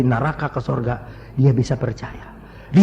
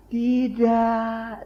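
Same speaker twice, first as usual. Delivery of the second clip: long drawn-out, higher-pitched no; serpent tempter's denial; 6 semitones higher than usual. Retold or imitated. Devil speaks through serpent to Eve, drawn-out denial. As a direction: imitate the tempter, stretching the word.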